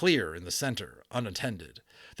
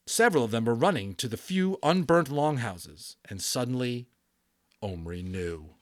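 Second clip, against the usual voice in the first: usual speaking voice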